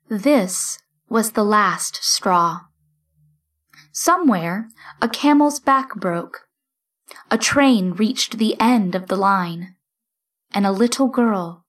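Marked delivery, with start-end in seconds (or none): none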